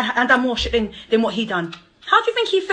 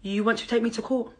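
ordinary talk

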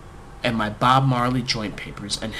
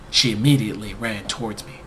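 usual speaking voice

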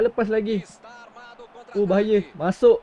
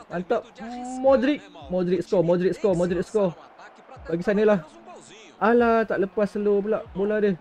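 normal talking voice

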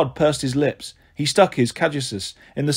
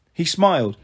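plain reading voice

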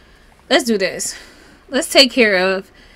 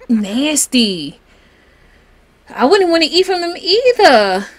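ordinary talk

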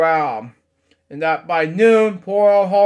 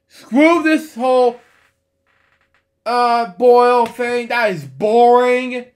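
loud, higher, exasperated complaint; fed-up outburst; 4 semitones higher, 6 dB louder than usual. Pretend. Reader rejecting the boring boil thing, loudly exasperated.

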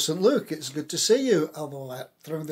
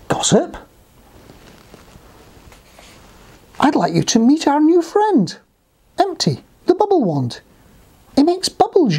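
high, presentational character voice; high-pitched storybook rabbit; introducing rabbit; 10 semitones higher, 8 dB louder than usual. Acted. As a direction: present a new friend with ceremony. Rabbit introduces new friend to mouse.

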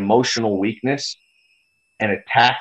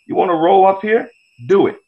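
raised, higher challenge to come over; taunting challenge; 7 semitones higher, 3 dB louder than usual. Retold or imitated. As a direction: dare them to show up, louder. Taunting fans quoted.

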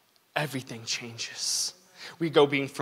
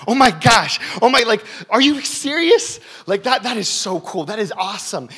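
much higher, louder, gushing in amazement; excited cashier; 8 semitones higher, 11 dB louder than usual. Retold or imitated. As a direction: gush like a stunned clerk at the register. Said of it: "He's like".

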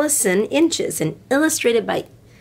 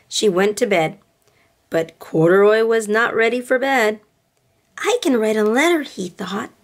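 gentle storybook read-aloud with voices; storybook reading for little girls; reading to children; 4 semitones higher than usual. A narrator's reading. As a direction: read it like bedtime story time. Reads picture book to girls.

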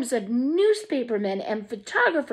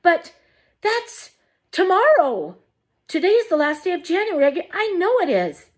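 high, louder and hurried; flustered alarm; 9 semitones higher, 3 dB louder than usual. A narrator's reading. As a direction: panic that it's already tomorrow. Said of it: "cried Missus Bucket".

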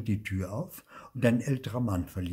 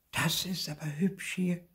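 murmured admiration; 10 semitones higher than usual. A narrator's reading. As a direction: marvel to yourself in a high voice. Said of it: "murmelte sie".

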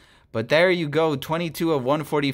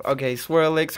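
ordinary delivery

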